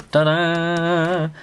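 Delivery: high, drawn-out fanfare; triumphant ta-da. Real, not acted. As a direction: sing out a playful reveal. Finished drawing revealed with a flourish.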